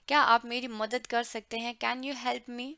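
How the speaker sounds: normal talking voice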